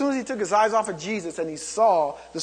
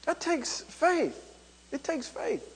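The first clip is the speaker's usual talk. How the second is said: hushed; 6 semitones higher, 9 dB quieter, slower than usual. Real, not acted. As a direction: drop to a soft, hushed voice for the point. Preacher hushes to drive point home.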